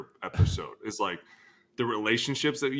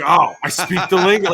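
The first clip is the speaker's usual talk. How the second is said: proud boast; 7 semitones higher, 9 dB louder than usual. Retold or imitated. Proud aside in story.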